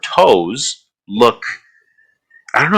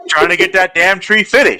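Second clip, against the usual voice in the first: tree fitty meme; 9 semitones higher than usual. Retold or imitated. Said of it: "ain't gonna get no damn tree fitty".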